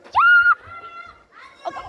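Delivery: high, long whoop of joy; joyful shout. Real, not acted. Kid whooping while bouncing, excited shout. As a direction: let out a triumphant yell.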